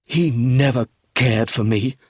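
slow, bitter, roughened voice; rough voice. A narrator's reading. Philip bitter about his father, rough voice. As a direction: dwell bitterly on the hurt. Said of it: "in a rough, low voice".

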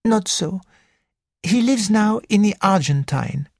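put-on high, lilting French-accented gentleman; a fussy Belgian detective, high and precise; French accented little detective. Porrett speaks.

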